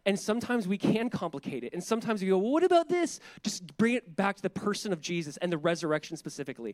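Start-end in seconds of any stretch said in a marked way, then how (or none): 2.4-3.2 high-pitched, loud mock questioning; mock objection. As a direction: butt in as a would-be sidetracker, voice jumping up. Voicing a sidetracking objection.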